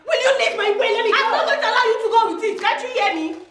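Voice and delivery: fast, high-pitched demand; angry quarrelling. Acted. Sister refusing to let the ring light go, angry. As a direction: argue hotly, pitch right up.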